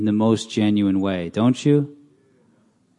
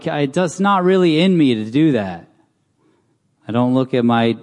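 ordinary talk, nothing marked

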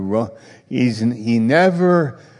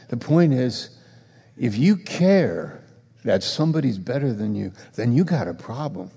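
ordinary talk, nothing marked

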